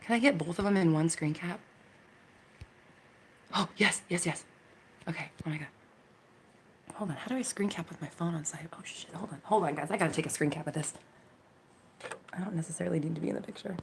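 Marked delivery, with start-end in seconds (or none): 4.1-13.8 hushed whisper while fumbling with the phone. Real, not acted. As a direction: whisper it to yourself, busy. Fumbling with phone mid-stream.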